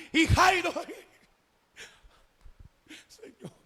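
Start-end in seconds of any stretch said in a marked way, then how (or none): none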